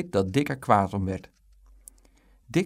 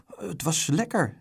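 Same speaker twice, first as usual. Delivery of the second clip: put-on slow, higher child's confession; drawn-out, higher little-boy reply; sheepish boy; 6 semitones higher than usual. A narrator's reading. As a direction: own up slowly in a small high voice. Dick owns up to mother.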